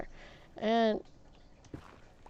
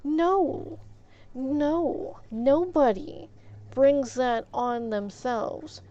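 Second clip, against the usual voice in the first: slow, raised-pitch, deliberate insistence; emphatic refusal; 3 semitones higher, slower than usual. Retold or imitated. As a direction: shut it down one phrase at a time. Emphatic rejection of victim blaming.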